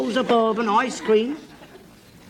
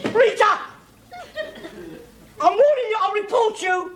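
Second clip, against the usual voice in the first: calling out threat; 10 semitones higher than usual. Real, not acted. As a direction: shout the threat across the house.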